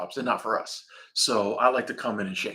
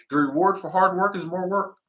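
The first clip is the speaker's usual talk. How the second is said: mock boss; 6 semitones higher than usual. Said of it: "I had a boss tell me that".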